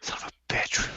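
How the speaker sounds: unvoiced under-the-breath curse; whispered curse. Real, not acted. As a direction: hiss it to yourself in dismay.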